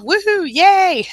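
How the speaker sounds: high, bright cheer read out for a viewer; excited cheer. Retold or imitated. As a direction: cheer along with her good news. Reading a viewer's happy comment.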